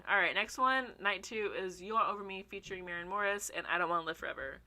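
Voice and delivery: normal talking voice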